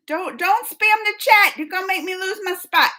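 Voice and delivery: mock stern plea to chat; playful scolding. Real, not acted. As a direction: scold the chat half-jokingly. Telling chat off.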